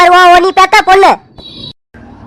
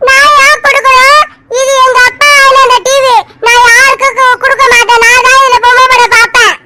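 put-on defiant child; 4 semitones higher than usual. Little Aisu refusing the remote to her aunt, bratty child voice. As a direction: play a stubborn little girl refusing.

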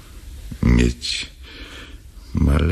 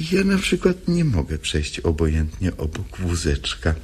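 usual speaking voice

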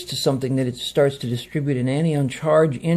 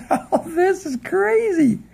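very high, slow laughing oh this is crazy; laughing disbelief; slower than usual. Real, not acted. Telling viewers about the nausea, high laughing disbelief. Laughing at own wild story.

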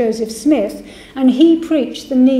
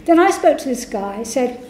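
usual speaking voice

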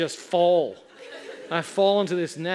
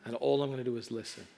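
low, hushed retold resolve; steady yourself softly before the jump; soft, lowered inner voice; quiet self-talk; 5 semitones lower, 8 dB quieter, faster than usual. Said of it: "so I just say".

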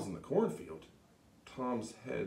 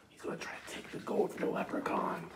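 whisper; faster than usual. Real, not acted. Excited whispered aside to children.

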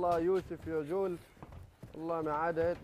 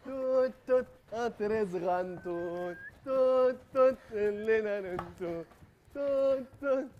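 high toot-toot train noises; 6 semitones higher, 5 dB louder, much slower than usual. Acted. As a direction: play a choo-choo train. Acting out a little train.

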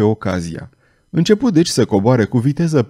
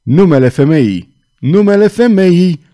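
loud, rising demand repeated; the knight roars for the name; shaken shout; 5 semitones higher, 6 dB louder than usual. A narrator's reading. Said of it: "strigă cutremurat cavalerul".

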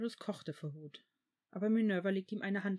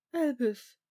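loud, higher imploring call; pleading; 5 semitones higher, 9 dB louder than usual. A narrator's reading. Minerva implores Albus to reconsider, pleading. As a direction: beg him with the name alone. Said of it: "flehte Minerva".

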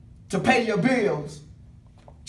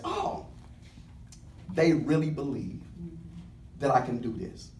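much lower and softer, a touched aside; quiet marvel; 8 semitones lower, 10 dB quieter than usual. Retold or imitated. Jesus about the believers, softly marveling. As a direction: murmur it like quiet amazement. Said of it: "He says".

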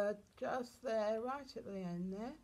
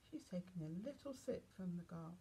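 ordinary delivery

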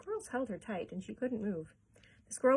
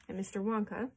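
plain reading voice

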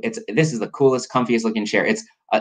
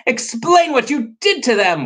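high, insistent storybook demand; indignant character voice; 3 dB louder than usual. Acted. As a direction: demand an explanation, pitch raised. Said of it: "Waffle demanded".

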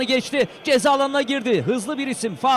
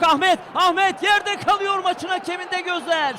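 urgent shouted play-by-play; screaming football commentator; 8 semitones higher than usual. Real, not acted. Live match, player down.

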